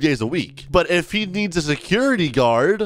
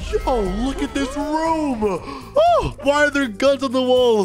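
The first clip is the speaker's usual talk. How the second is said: high-pitched, wowed exclaiming; amazed excitement; 9 semitones higher, slower than usual. Acted. Guard seeing guns in his new room, amazed excitement. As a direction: marvel at it with pitch way up.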